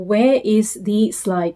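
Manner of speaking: put-on very slow, every word spelled out for a small child; slow question to a toddler. Shown as a demonstration. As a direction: ask it slowly so a toddler can follow. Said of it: "So here I would say".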